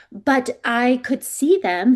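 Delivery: ordinary delivery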